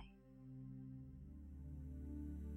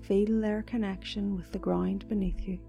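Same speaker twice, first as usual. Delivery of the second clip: soft, slow meditation voice; guided meditation; 2 semitones higher, 10 dB quieter than usual. Acted. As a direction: guide them gently into calm.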